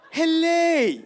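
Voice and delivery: put-on loud high hello said hele in an African accent; dad's hello. Comedian doing his dad answering the phone, shouted accented hello. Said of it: "mix up E's and O's".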